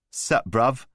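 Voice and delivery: lightly voiced swaggering chav; cocky street thug. A narrator's reading. Voice of Newman the thug.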